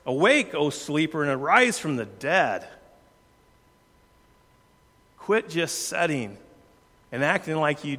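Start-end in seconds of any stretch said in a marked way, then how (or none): 0.0-2.7 Awake called out higher and louder, rest read firmly; emphatic scripture. Shown as a demonstration. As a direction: boom out "Awake" like a wake-up call, then press on. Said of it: "very emphatic words".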